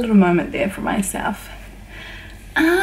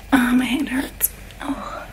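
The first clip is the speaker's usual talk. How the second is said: soft whispered complaint; pained whisper; 5 dB quieter than usual. Real, not acted. Whispering to viewers about her sore hand. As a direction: whisper the little pain.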